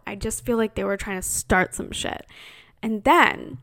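ordinary talk, nothing marked

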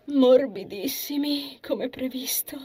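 stretched first word, then breathy release; relieved sigh. A narrator's reading. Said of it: "sospirò di sollievo".

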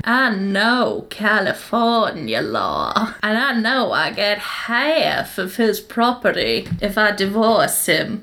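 put-on slow Southern US accent; attempted Southern accent. Laurie confiding divorce plan to Linda, slow Southern accent. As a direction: drag it out in an American Southern accent. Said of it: "I'm gonna attempt the accent".

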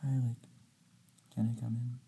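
imitating a timid girl in a tiny, very soft voice; timid, barely audible. Shy girlfriend asking to come into the room, timid and very quiet. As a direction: barely speak up, asking to enter. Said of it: "never seen her speak louder than".

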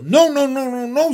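high, loud string of frantic refusals; alarmed goose. A narrator's reading. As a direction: squawk it out in alarm. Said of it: "said the goose".

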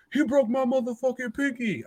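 very high-pitched mocking imitation; high mock wail; whining kid. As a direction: mimic an injured guy squealing his complaint. Mimicking injured kid's whining complaint.

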